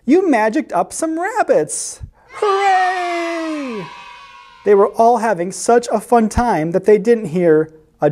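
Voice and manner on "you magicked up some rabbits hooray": high, delighted cat voice; jubilant, pitched way up; cheering. A narrator's reading. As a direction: cheer it, ending in a long high whoop. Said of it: "the other cats cheered".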